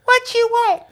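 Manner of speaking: high, loud call from afar; distant call. Acted. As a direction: shout back from across the carnival. Fairy calls from far away.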